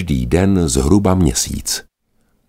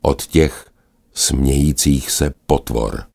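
ordinary delivery, nothing marked

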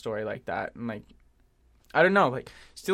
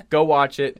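laughing; 9 dB louder than usual. Real, not acted. Laughing at the clip.

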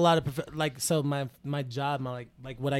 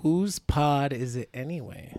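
slow title read; 7 semitones lower, 5 dB quieter, slower than usual. Pretend. Repeating show title joke.

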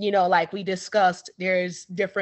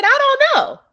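a much higher, louder squeak of I don't know; high squeal; 8 dB louder, faster than usual. Retold or imitated. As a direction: squeak it out high and loud as a baffled shrug. Retold reply to other patient.

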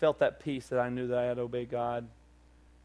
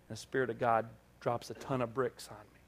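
ordinary talk, nothing marked

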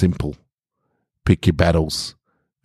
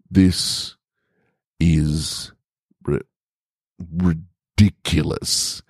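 very slow, halting, stumbling over ri-; slow stammer; much slower than usual. Acted. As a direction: splutter it out word by word. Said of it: "he stammered slowly".

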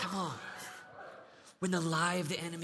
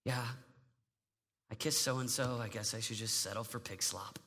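low, soft, slow resigned voice; defeated teen; 8 semitones lower, 9 dB quieter than usual. Acted. Teen giving in to the devil's lie, low and defeated. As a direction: sink into hopeless self-pity. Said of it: "They listen to the devil".

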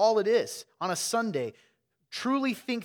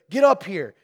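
a firm mother calling her son up, raised; mock stern call; 10 dB louder than usual. Mother ordering her son to come, loudly. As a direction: call him over like a stern mom. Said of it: "she's like".

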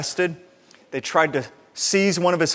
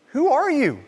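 baffled question; 10 semitones higher than usual. Retold or imitated. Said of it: "people sitting around going".

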